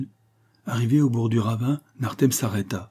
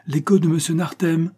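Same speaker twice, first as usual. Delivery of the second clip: high-pitched call; 8 semitones higher, 4 dB louder than usual. A narrator's reading. Châtelain to the hidden child, calling out. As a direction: call it out to be echoed.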